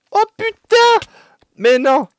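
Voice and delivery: high, loud exasperated cry; frustrated yell. Real, not acted. Caught by the bomb carrier.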